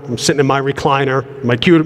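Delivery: usual speaking voice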